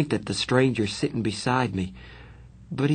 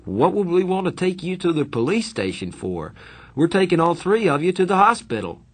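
higher, louder, amused adult voice; surprised fireman; 8 semitones higher, 3 dB louder than usual. A narrator's reading. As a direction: set the kid straight, surprised.

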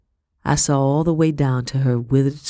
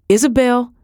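high, louder urgent plea; urgent call; 9 semitones higher, 5 dB louder than usual. Acted. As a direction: call her name with alarm. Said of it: "Becky tried again".

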